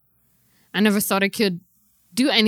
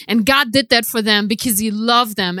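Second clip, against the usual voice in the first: ordinary talk, nothing marked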